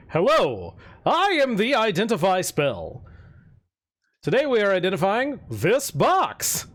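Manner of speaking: lightly voiced little spell sprite; a hint of a chirpy magic voice; tiny tinkling snowflake sprite. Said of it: "Do the voice".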